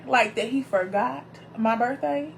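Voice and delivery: ordinary talk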